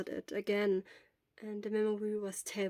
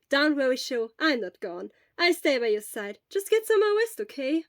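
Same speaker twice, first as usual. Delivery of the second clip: reassuring friend; 4 semitones higher, 7 dB louder than usual. Acted. Hisashi reassures sick friend.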